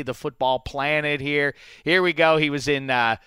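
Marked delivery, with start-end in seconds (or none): none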